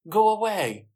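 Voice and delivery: loud, high order to leave; shout. A narrator's reading. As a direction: shout him away sharply. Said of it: "she shouts at the man".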